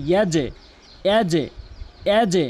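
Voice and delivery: ইয়াজে with the j bounced into a short "e"; downward bounce. Shown as a demonstration. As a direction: push the end of ইয়াজে sharply downward. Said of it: "নিচের দিকে ধাক্কা হবে". (ইয়াজে-তে নিচের দিকে ধাক্কা)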